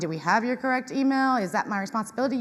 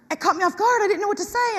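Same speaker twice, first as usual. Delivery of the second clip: flustered coworker; 10 semitones higher, 4 dB louder, faster than usual. Retold or imitated. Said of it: "she was like".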